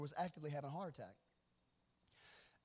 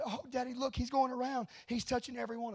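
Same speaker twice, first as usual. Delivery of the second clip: high, louder imitation of a wonderstruck boy; excited six-year-old; 7 semitones higher, 6 dB louder than usual. Young son telling Daddy what he sees, high excited child voice. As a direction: voice an eager child reporting a marvel. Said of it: "At the time, he's six".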